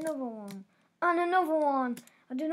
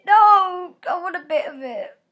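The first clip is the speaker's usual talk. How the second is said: loud shout; 6 semitones higher, 16 dB louder than usual. Real, not acted. Shouts at losing item.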